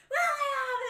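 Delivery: high, loud shocked shriek of his name; a girl shrieking at a prank, outraged; outraged shout. Retold or imitated. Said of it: "she turned and she goes".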